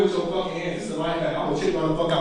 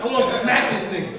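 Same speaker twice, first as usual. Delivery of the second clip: normal talking voice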